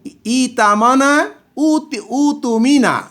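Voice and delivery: walk from ইতামানা to উতুমিনা slowly; slow model words. Shown as a demonstration. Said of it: "passive voice হয়ে গেছে".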